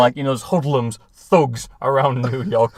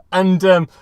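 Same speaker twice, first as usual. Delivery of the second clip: usual speaking voice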